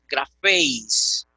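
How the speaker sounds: graféis drawn out very slowly, stress on the éis diphthong; slow Greek pronunciation. Shown as a demonstration. Teacher modelling graféis for Greek students, slow and careful. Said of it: "Ahora decimos graféis".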